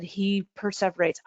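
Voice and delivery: ordinary talk, nothing marked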